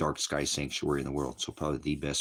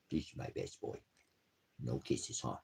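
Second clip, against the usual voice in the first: whispered, much softer; 6 semitones lower, 11 dB quieter than usual. Real, not acted. Man whispers softly to his dog nuzzling him. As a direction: hush it close to the dog.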